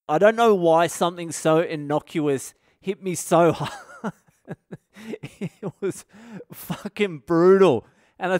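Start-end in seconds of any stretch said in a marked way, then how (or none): none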